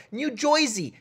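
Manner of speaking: thick local New Jersey "Joisey"; New Jersey accent, "oi" for the "er" in Jersey. Shown as a demonstration. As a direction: say Jersey as "JOY-zee", New Jersey style. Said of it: "that's how you say the word New Jersey in New Jerseyan".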